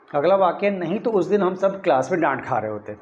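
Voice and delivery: normal talking voice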